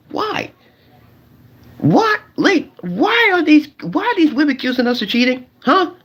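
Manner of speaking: squeaky high, mock-outraged protest; mock indignant why. To the fellas, protesting accusations of cheating with high mock outrage. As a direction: throw the why up high in fake disbelief.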